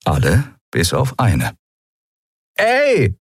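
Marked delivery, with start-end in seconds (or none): 2.6-3.2 high, sharp hail; calling out. A narrator's reading. Pirate woman hailing her companions from the door, calling out. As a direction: call your crew back with one high cry. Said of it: "rief die Menschenfrau mit dem Apfel ihren Kumpanen zu".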